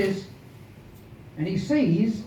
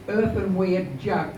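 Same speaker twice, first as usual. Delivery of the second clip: plain reading voice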